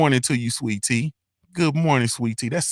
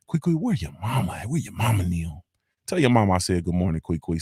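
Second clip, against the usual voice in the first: put-on voice; 6 semitones lower, 4 dB quieter than usual. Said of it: "I gotta put that voice".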